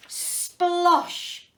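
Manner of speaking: big loud splosh; loud splash word. A narrator's reading. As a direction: burst out with a loud high splosh. Splash in story.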